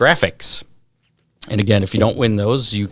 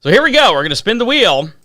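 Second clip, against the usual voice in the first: hyped giveaway announcement; 11 semitones higher, 8 dB louder, faster than usual. Real, not acted. Spinning giveaway wheel live.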